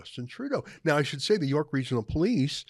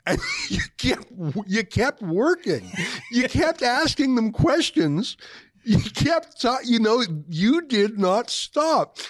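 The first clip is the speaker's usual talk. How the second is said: chuckling, words pitched much higher and louder; laughing in disbelief; 8 semitones higher, 4 dB louder than usual. Real, not acted. Host chuckling at the journalist who kept asking questions, laughing. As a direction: marvel through laughter at his persistence. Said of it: "I can't help but chuckle".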